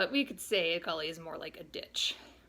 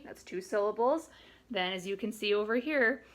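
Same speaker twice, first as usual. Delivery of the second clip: ordinary talk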